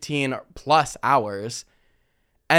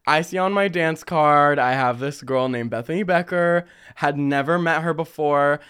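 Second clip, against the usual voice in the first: ordinary talk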